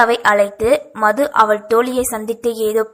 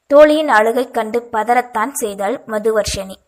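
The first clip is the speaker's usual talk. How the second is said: plain reading voice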